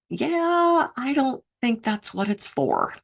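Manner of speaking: high, drawn-out, softening reluctance; doubtful woman. Retold or imitated. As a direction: imitate someone gently breaking bad news, slowly. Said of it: "this woman goes".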